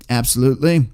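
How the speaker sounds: ordinary talk, nothing marked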